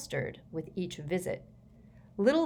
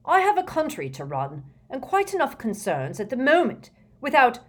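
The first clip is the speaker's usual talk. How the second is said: louder, climbing irritated protest; snapping; 7 semitones higher, 6 dB louder than usual. A narrator's reading. Prime Minister protests he has a country to run, snapping. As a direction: complain testily, rising until cut off. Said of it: "snapped the Prime Minister now".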